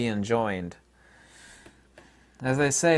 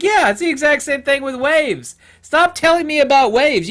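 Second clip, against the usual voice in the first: loud, high-pitched exasperated rant; exasperated outburst; 13 semitones higher, 9 dB louder than usual. Acted. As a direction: blurt it out, fed up and loud. Exasperated rant at wave talk.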